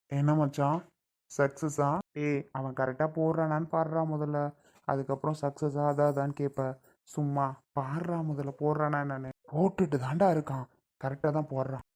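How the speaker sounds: low, soft aside between accomplices; low-voiced check with a partner; hushed aside. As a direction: confer quietly, keeping it low. Accomplices confer while victim signs.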